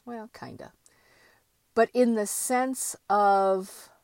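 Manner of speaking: normal talking voice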